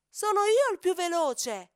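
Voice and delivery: loud, high brag with IO punched; boasting shout. Acted. Cheetah bragging to the teachers, loud and high. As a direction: crow it to the whole field.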